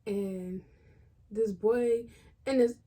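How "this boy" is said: normal talking voice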